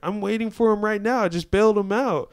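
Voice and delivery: imitating an amazed woman; retold amazement. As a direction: blurt it out as astonishing news.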